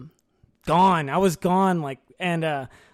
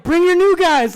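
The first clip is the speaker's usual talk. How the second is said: higher, louder call to the squad; rallying call; 8 semitones higher, 7 dB louder than usual. Retold or imitated. Urging the other unit to join in, loud call. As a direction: shout for them to bring everyone.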